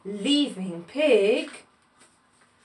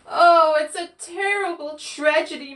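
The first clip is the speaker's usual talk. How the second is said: moaning; 5 semitones higher, 5 dB louder than usual. A narrator's reading. Pig despairs at the spot Goat made worse, moaning. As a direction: moan it theatrically, pitch raised. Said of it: "moaned Pig".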